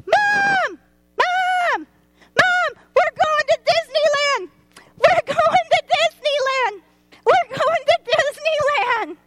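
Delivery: put-on a girl screaming to her mom about Disneyland; screaming child. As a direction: shriek it like an overjoyed little girl. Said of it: "I'm gonna scream".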